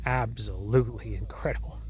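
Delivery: whispered in awe; awed whisper. Real, not acted. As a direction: breathe it out, amazed and unvoiced. Awed aside on the slope.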